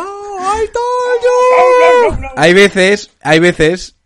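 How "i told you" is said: very slow, stretched-out "I told you"; drawn-out taunt. Pretend. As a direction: drag every word out teasingly. Catchphrase drawn out. (burla muy lenta y estirada)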